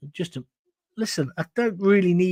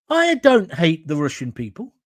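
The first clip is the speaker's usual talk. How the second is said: ordinary talk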